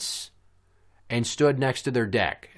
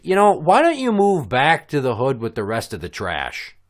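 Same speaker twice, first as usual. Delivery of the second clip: louder, raised-pitch taunt; pointed, performed put-down; retold parting jab; 4 semitones higher, 6 dB louder than usual. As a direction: throw the insult over your shoulder. Acting out his parting insult.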